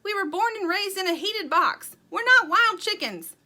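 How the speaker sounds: high, loud exasperated retort; scolding yell. A narrator's reading. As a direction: shout down a silly idea. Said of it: "yelled Sugar".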